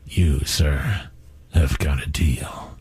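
very low, slow, hushed movie-trailer voice; deep dramatic whisper. Pretend. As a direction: growl it out low and breathy like a trailer narrator. Host mock-dramatic reply to caller.

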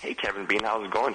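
usual speaking voice

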